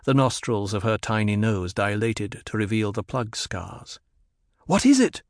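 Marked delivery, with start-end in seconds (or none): 4.7-5.2 high, urgent demand. A narrator's reading. Jessica pressing Tharthar for news, urgent demand. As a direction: demand an answer, pitch jumping up. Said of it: "Jessica demanded".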